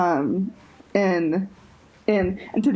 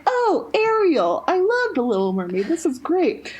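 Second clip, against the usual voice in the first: gushing excitement; 9 semitones higher than usual. Retold or imitated. Host retelling her first thrill at the mermaid topic, gushing. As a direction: replay your own delight, pitched way up. Acts out delight at topic idea.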